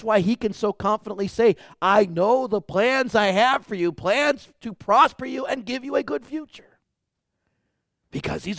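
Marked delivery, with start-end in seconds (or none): 1.8-6.8 high, loud proclamation; preaching loud. Acted. Pastor proclaims God's control, loud and high. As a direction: proclaim it with full force. Quoting God in sermon.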